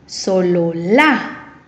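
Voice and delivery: "solo la" slow and low, rising hard onto LA; stress on last syllable. Shown as a demonstration. Teacher gives an example word, "solo la" stressed on LA. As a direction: hold back "solo", then punch a long LA. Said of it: "¿Escuchas la fuerza de voz?".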